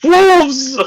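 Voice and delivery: high, puffing cry; panting alarm. Acted. Hurt man comes round raving of wolves, alarmed. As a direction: gasp out the word in mock panic. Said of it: "He's like".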